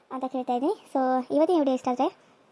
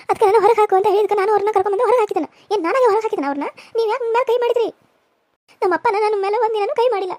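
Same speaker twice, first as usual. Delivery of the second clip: put-on young wife protesting, higher and tearful; hurt young wife; 6 semitones higher, 6 dB louder than usual. Young wife to husband who hit her, tearful protest. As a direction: demand answers through tears of hurt.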